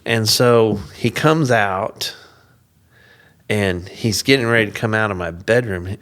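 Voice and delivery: normal talking voice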